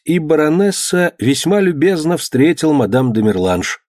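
ordinary delivery, nothing marked

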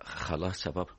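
subdued surrender. Acted. Son giving in to his scolding father, subdued. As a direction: back down quietly.

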